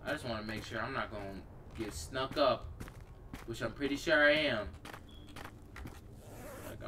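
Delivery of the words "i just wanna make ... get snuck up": ordinary talk, nothing marked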